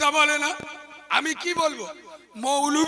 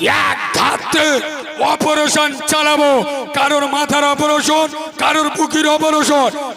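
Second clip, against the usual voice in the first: loud sermon roar, hammering out each word; fiery preaching; 3 semitones higher, 8 dB louder than usual. Acted. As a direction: thunder the list at the crowd like a preacher. Waz preacher at full force.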